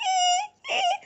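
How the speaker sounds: very high crying ee; bird squeal. Pretend. Storyteller squeals like a baby bird, very high. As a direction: squeal like a chick. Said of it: "Their voices also sounded different".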